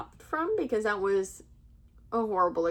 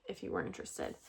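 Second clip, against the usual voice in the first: whispered; 11 dB quieter, faster than usual. Retold or imitated. Retold quote, whispered end.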